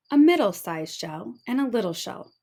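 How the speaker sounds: ordinary delivery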